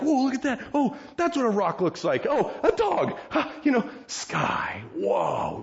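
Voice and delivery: high, awestruck exclamations; childlike wonder. Acted. Healed blind men marvelling at rocks and dogs, high and amazed. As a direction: gasp at everything like seeing it the first time.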